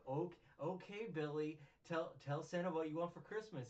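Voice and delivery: high, loud, coaxing mom voice; imitated mom. Mom brings kid to Santa on break, coaxing imitation.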